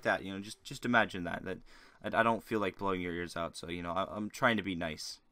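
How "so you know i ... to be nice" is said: usual speaking voice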